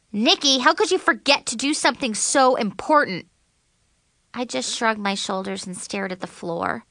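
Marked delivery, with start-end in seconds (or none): 0.1-3.3 higher-pitched accusing question; dismayed reproach. A narrator's reading. Friend asking how she could forget, dismayed reproach. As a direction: reproach her in a raised pitch.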